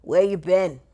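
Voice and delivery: a hint of a tough New York director; light New York accent, blunt question. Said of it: "deep, like, New York accent".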